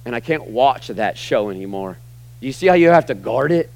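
ordinary delivery

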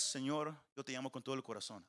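fast, low, toneless run-through; rote reading. Shown as a demonstration. Preacher showing how we read the prayer, rote and hurried. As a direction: rattle it off softly without feeling. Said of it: "Así lo leemos nosotros. Pero a-, así no se dijo".

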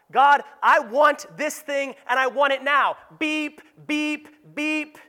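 high, loud mock demand to God. Believer pushing God's timing, loud mock-demanding. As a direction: insist like an impatient child, ending in high beeps. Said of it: "don't we do this with God".

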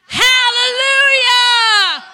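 long, high, very loud hallelujah shout; drawn-out joyful church shout; big shout. Real, not acted. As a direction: shout "Hallelujah" out to the Lord at full force. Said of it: "Let's shout hallelujah".

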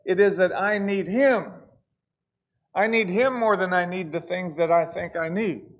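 loud, rising preacher's cry, leads held hard; preaching at full force. Acted. Preacher pleading with the Smithfield church, loud and fervent. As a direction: pour it out like a revival preacher.

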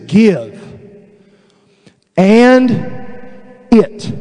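one word at a time, long pauses; real slow reading. Shown as a demonstration. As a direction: spell out the verse word by word. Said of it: "read it to you real slow".

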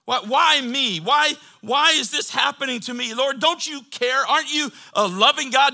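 loud, high-pitched pleading; impassioned mock complaint to heaven; acted anguished protest. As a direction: cry out as a doubting believer. Preacher acts out anguished complaint to God.

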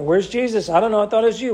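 mild mock alarm at losing the boy; panicked parent. Mary and Joseph panicking on the journey, hammed-up worry.

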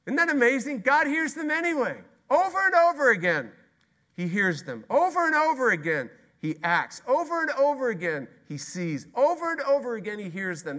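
high, loud, ringing sermon; fiery preacher building to a peak; full-force preaching. Acted. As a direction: preach it out with mounting wonder.

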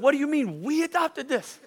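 high-pitched incredulous echo; retold disbelief. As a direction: throw the words back, comically baffled. Disbelief at wife's news.

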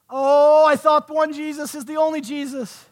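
high, loud feigned puzzlement; mock surprise. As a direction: stretch the "oh" as if taken aback. Feigns surprise at another Jesus.

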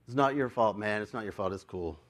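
soft, low, settling down; reassuring. Retold or imitated. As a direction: let him off gently, voice dropping away. Reassuring the young driver.

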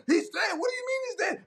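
very high, squealed disbelief; hammed-up high-pitched panic; mock shock. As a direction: imitate someone reeling at news of a death. Acts out shocked mourners.

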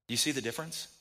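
ordinary delivery

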